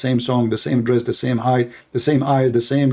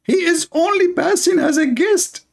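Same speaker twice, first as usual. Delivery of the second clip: squeaky mock heavenly wife scolding the earthly wife; mocking huri voice. Huri scolding the wife who harms her husband, in a high mock voice. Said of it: "she will say".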